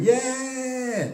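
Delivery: high, drawn-out imitation of a fan's cheer; gloating rugby fan's stretched-out cheer; mock cheer. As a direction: ham up a celebrating Scotland fan. Imitating Scottish fans celebrating.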